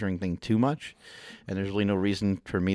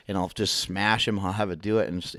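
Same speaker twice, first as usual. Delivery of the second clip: ordinary delivery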